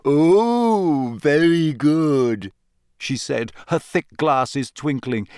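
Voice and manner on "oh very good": long, sliding ghostly coo; slow, swooping ghost voice; moping ghost girl. A narrator's reading. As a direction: draw it out as a teasing ghost.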